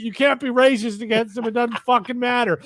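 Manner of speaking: high, mocking retort; mock dismissive commenter. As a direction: toss it off up high like a know-it-all.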